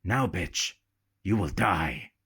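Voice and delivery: low, calm menacing captain; pirate captain threatening Isabel, low and matter of fact. A narrator's reading. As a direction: state it coldly, no heat at all. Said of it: "and calmly, all matter of fact".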